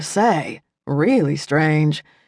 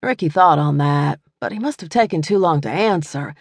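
plain reading voice

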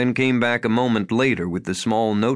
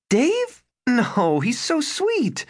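surprised disbelief; 13 semitones higher, much slower than usual. Acted. Wife defends friend's husband.

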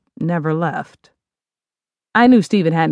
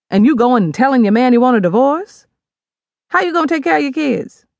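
louder lightly voiced mom, rising; scolding mother; 5 semitones higher, 4 dB louder than usual. Mother scolding daughter over divorce, insistent. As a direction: lay down the law.

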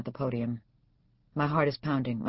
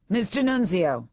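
furious; 6 semitones higher, 6 dB louder than usual. A narrator's reading. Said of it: "Bitterman is suddenly furious".

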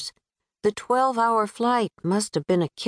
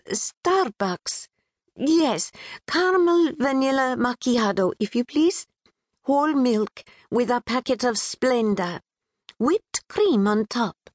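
put-on a touch of Pakistani accent; 6 semitones higher than usual. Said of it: "a bit sing-song, infused with a British twang".

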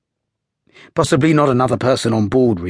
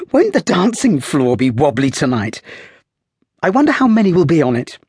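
put-on light, high, lively young lady; playful high girl's voice, teasing; young woman's voice; 7 semitones higher, 3 dB louder than usual. A narrator's reading. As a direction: raise the voice into a spirited young woman. Girl voiced in story.

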